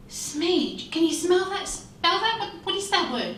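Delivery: high, puzzled questioning; baffled professor. Acted. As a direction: question the strange word in disbelief.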